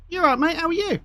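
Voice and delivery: high-pitched chirpy greeting, acted; a hint of a high-voiced joke character. Visitor greets horse in told joke.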